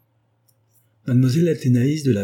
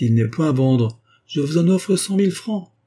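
rising insistence; 7 semitones higher, 3 dB louder than usual. Acted. Flavia surenchérit face au baron.

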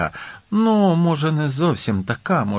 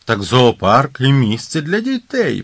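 ordinary delivery, nothing marked

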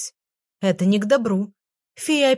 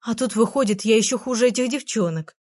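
plain reading voice

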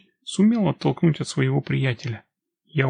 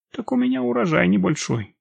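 higher, lightly coquettish; flirtatious; 7 semitones higher than usual. A narrator's reading. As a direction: play coy, voice pitched up. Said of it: "я продолжала кокетничать".

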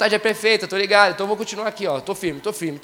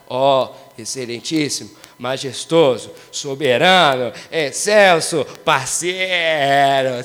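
low, slow, grandiose mock-solemn voice; pompous formal address; 7 semitones lower, 4 dB louder, much slower than usual. Shown as a demonstration. Mocking stiff formal prayer to God, slow pompous voice. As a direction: drag each title out like a royal herald, deep and slow. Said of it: "você não precisa falar assim".

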